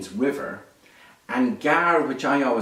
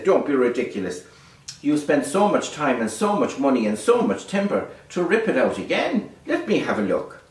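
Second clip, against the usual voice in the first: raised, scolding king's voice; indignant king; 5 semitones higher, 4 dB louder than usual. Acted. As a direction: scoff at them like an offended king. King scolds the boat builders.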